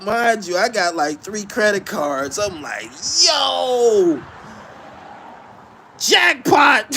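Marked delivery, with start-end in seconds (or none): 3.2-6.9 high, loud, gleeful cry; excited jackpot shout. Retold or imitated. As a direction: yell it like you hit the jackpot. ATM spits out money.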